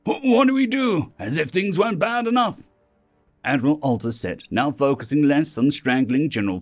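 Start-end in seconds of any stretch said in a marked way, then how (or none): 0.0-2.6 high-pitched, alarmed outburst; stammering, high, distraught officer; panicked dismay. A narrator's reading. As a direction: wail it in rising panic. Admiral panicking at alien fleet news.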